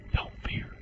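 hushed, breathy reassurance; a soft whispered comfort. Acted. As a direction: whisper it close to them. Hushed reassurance after quote.

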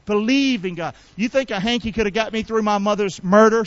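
usual speaking voice

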